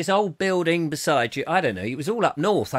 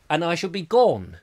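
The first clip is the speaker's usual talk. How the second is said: normal talking voice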